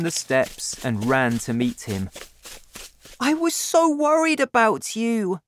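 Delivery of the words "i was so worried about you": put-on very high, raised child's voice; high-pitched childlike outburst; crying out. A narrator's reading. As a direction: cry out as a worried, relieved boy. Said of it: "I cried".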